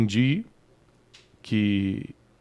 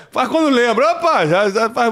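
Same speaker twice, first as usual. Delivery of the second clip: laughing; 10 semitones higher, 7 dB louder than usual. Real, not acted. Joking about rarely having sex.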